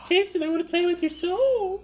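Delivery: high, eerie little doll voice; sing-song creepy toy doll. Pretend.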